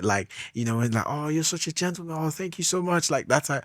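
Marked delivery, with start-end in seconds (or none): 1.1-3.1 higher, softer grateful voice; appreciative lady. Acted. Grateful lady thanking the gentleman for holding the door, appreciative. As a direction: lightly mimic a woman gushing thanks. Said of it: "when she's, like, really appreciative of it".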